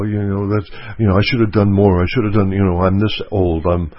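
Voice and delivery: normal talking voice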